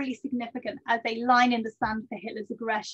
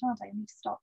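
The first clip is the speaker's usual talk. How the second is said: ordinary delivery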